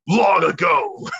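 big bombastic narrator, loud and slow. Acted. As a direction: boom it out like an epic storyteller. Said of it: "read it in your narrator voice".

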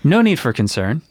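ordinary talk, nothing marked

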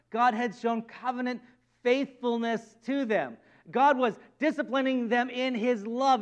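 normal talking voice

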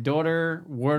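"daughter" and "water" in a Philly accent. Shown as a demonstration. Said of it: "that was the way that they said it".

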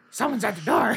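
goblin voice. Acted. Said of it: "because I speak Goblin".

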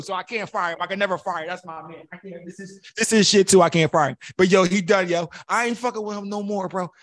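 ordinary delivery

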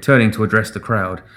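usual speaking voice

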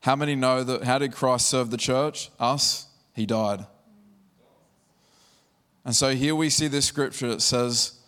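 normal talking voice

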